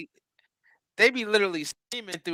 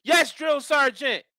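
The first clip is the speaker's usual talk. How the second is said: slow, higher recruit shout; barked military recruit reply; 6 semitones higher, slower than usual. Pretend. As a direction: answer like a snapped-to recruit. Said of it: "T-Bone the drill sergeant".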